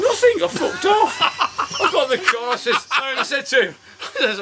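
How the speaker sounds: laughing at his own story. Real, not acted. Teller laughing recalling he left, real laughter.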